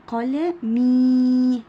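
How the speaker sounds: قلمي with a long, loud held ee at the end; teacher's drawn-out قلمي; elongated final vowel. Shown as a demonstration. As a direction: sound out قلمي slowly, stretching the final ee. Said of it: "صوته بيكون طويل".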